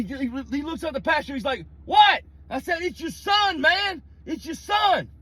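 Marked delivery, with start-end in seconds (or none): 1.9-2.2 high, loud shouted what; shouting across the pasture; distant call back. Retold or imitated. Dustin calling back from far. 2.8-5.1 high urgent shout; alarmed shouting to the father; panicked yelling. Retold or imitated. Yelling across pasture in panic.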